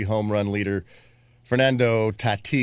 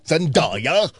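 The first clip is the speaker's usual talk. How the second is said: imitated British accent; 7 semitones higher, 5 dB louder than usual. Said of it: "the way he says her name".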